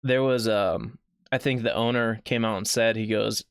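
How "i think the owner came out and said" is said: usual speaking voice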